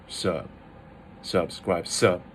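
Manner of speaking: "sub" in a low, dropped tone; third tone. Shown as a demonstration. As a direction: let "sub" drop low and soft. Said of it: "it's the third tone".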